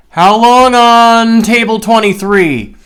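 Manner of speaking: high, loud, slow mock server voice; drawn-out pushy server imitation; server asking. Server leans into the window, asking about table twenty-three, high and loud.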